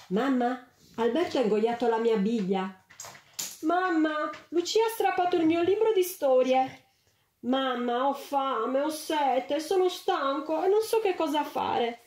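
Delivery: put-on high, whiny kids' voices calling mamma; whining children. A narrator's reading. Tre bambini che assillano la mamma, lagnosi e acuti. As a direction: nag like small children all at once. Three children pestering their mother.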